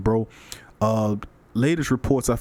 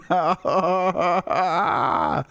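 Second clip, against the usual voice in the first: real laugh; 8 semitones higher than usual. Real, not acted. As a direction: crack up at the comment. Reading a funny viewer comment.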